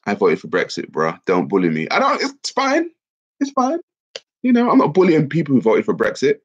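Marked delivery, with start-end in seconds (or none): 1.9-6.4 much higher, playful defensive voice; high-pitched protest. Real, not acted. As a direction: protest your innocence in a high voice. Jokingly denies bullying Brexit voters.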